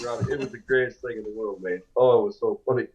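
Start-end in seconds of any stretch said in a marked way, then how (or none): none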